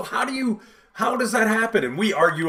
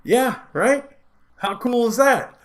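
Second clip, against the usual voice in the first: smug buddy; 8 semitones higher, slower than usual. Retold or imitated. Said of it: "he looks at me and goes".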